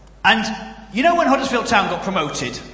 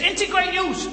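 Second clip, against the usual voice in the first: put-on high, excited northern English accent; Huddersfield accent; 9 semitones higher than usual. Joyous fan telling a stranger about promotion, high Huddersfield accent. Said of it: "quite a good Huddersfield accent".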